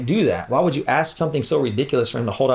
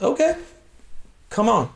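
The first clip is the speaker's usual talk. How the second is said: amused dare; 10 semitones higher than usual. Retold or imitated. Jesus calling Peter's bluff.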